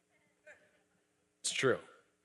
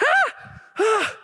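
two sharp high shrieks, much higher and louder; retold shriek; 8 dB louder than usual. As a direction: yelp twice like a startled man seeing Bigfoot. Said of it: "he kinda shrieked".

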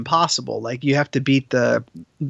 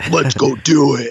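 slow, revved-up hype voice; ready to charge in; pumped-up gamer bro; 5 dB louder, slower than usual. Pretend. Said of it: "you sound like, you've been, like, drinking Powerade".

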